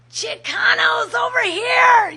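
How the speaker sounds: high, loud, slow rallying shout; screaming. Retold or imitated. Old timer on a table calling Chicanos to his side, screaming. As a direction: bellow it to rally your side. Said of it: "he's screaming".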